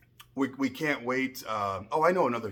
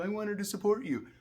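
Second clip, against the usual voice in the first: whiny imitation; 8 semitones higher, 4 dB quieter than usual. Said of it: "And she's like".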